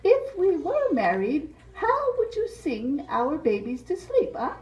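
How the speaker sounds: Mariposa voice. Acted. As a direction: play the flirty butterfly asking her suitor. Mariposa voice, held role.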